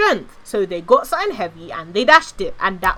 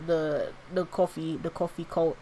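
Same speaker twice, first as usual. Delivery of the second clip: quiet; 6 semitones lower, 11 dB quieter than usual. Real, not acted. Mock-disapproving aside.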